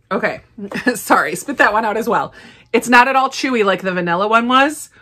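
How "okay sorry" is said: ordinary talk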